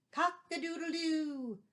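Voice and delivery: high crowing rooster call. Acted. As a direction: crow it out like a rooster. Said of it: "He crowed loudly".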